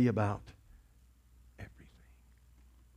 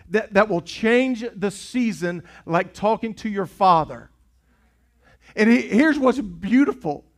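louder insistent preaching; impassioned preaching; 4 semitones higher, 6 dB louder than usual. Acted. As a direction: drive the point home to the room. Preaching at full force.

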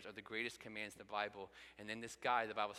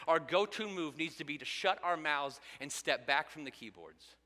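preaching at full force; 5 semitones higher, 8 dB louder than usual. Acted. Impassioned sermon climax.

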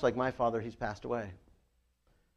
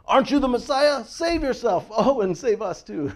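high, loud, mocking jeer; hurled insults; 9 semitones higher, 5 dB louder than usual. A narrator's reading. Preacher voicing a criminal on the cross insulting Jesus, jeering. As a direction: fling it out as a taunt, pitched high. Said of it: "hurled insults at him".

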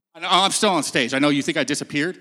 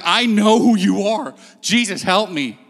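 slow, a bit louder cry for attention; retold yell; 3 semitones higher, 4 dB louder than usual. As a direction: call out to get his attention. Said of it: "as he is yelling".

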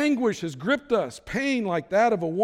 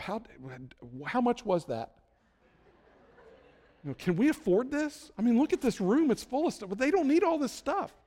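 fretting dad; 6 semitones higher than usual. Retold or imitated. Dad's worried thoughts watching gifts.

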